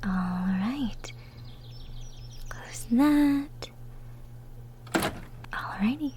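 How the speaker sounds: short hushed whispers. Acted. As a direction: murmur to yourself under your breath. Closing up the cottage.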